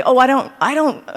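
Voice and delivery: protesting non-musician. Retold or imitated. Voicing people who say they can't compose.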